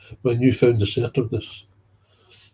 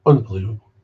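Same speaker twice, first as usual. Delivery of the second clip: louder exasperated exclamation; loud disbelief; 8 dB louder, faster than usual. Real, not acted. Exasperated at sponsors, louder. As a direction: throw it out in disbelief.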